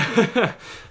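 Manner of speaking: sudden high, loud laugh; burst of laughter. Real, not acted. Told to go on with his story, bursting out laughing.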